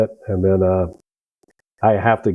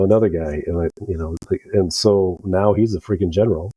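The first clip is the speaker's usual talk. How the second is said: ordinary talk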